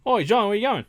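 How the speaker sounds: put-on loud, high call in a wobbly British accent; a British accent drifting toward Australian; mock British accent. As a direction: shout across the office like a cockney boss. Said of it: "That accent went, like, four different places".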